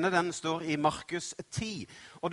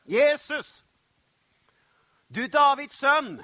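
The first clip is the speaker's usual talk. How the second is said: full, high-pitched shout; loud, urgent cry to be heard; shouting even louder; 9 semitones higher, 8 dB louder than usual. Acted. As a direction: yell desperately over those hushing you. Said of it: "roper bare enda høyere".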